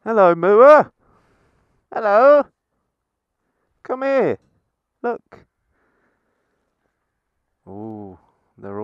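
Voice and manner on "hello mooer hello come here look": put-on high, loud, eager kid voice; giddy childish calling; childlike excitement. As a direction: call to the cows like an excited toddler.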